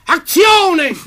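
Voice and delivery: loud, high Italian shout of Azione; Italian director. Retold or imitated. Italian director yelling at the American actor, loud Italian shout. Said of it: "there's an Italian director. He says".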